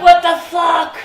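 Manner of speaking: high, laughing what the fuck; laughing disbelief. Retold or imitated. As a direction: cry out half laughing in shock.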